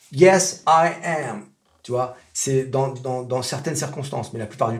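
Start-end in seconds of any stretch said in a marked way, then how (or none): 0.1-1.5 "Yes, I am" with YES loud and AM given in full; firm, emphatic "I am", uncontracted; insistent full form. Shown as a demonstration. Teacher showing how to insist, emphatically stressed. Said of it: "quand vraiment vous insistez".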